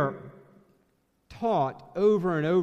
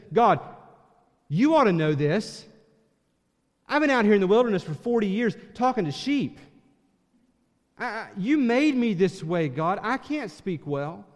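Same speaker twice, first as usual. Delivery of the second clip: complaining; 8 semitones higher, 6 dB louder than usual. Retold or imitated. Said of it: "this is a complaint".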